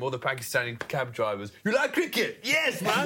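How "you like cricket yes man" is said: high, bright put-on voice of a cab driver; imitated cab driver accent. Imitating Pakistani cab drivers at the cricket, high put-on voice. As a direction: mimic the excited cab driver.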